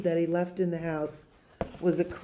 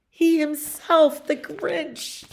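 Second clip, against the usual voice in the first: much higher and louder, slowed; dramatic build; 10 semitones higher, 5 dB louder, slower than usual. A narrator's reading. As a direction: make it a big reveal. Dramatic reveal in story.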